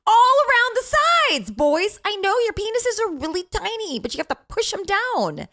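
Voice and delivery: high, loud exasperated telling-off; scolding mom. Retold or imitated. Mother scolding her boys about the toilet, high and loud. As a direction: bark it at the boys, half laughing. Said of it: "you guys".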